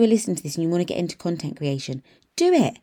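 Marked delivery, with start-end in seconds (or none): 2.4-2.8 higher and louder, jolting the listener into action; fired-up push. Real, not acted. Mum urges would-be content creators, fired up and loud. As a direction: shout them off the sofa.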